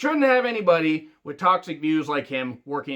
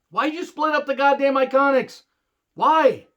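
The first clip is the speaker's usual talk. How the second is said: higher, louder and fed up; exasperated outburst; 9 semitones higher, 4 dB louder than usual. Real, not acted. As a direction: yell the question in disbelief. Repeated rant about Iconics split.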